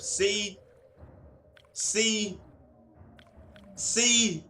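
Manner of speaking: loud, high, rising each time; excited vindication. Real, not acted. Proving his point to viewers, loud excited repeats. Reacting to unknown ocean species.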